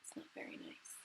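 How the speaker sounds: a soft whispered aside. Real, not acted. Reader whispering a comment to the child. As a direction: lean in and whisper it. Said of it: "aside whisper to listener".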